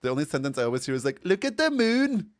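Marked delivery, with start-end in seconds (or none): 1.2-2.3 high, loud, slow mock Scottish; Scottish accent. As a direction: belt it out in a put-on Scottish accent. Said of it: "Scottish is also really hard".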